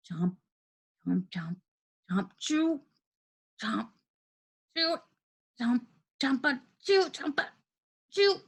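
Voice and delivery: halting, effortful chomps with pauses between; chomping noises. A narrator's reading. Narrator acting out Henry stuffing candies in his mouth, laboured chomping. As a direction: chew noisily through each word. Narrator acts out candy chewing.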